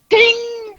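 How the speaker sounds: high, slow sung-out ding; phone ding sound effect; imitated phone ding. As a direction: mimic a notification chime.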